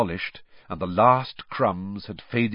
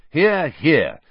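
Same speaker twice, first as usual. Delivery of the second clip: a louder cheer, higher up; 7 semitones higher, 8 dB louder than usual. A narrator's reading. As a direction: call it out over the table. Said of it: "said Harry and Ron loudly".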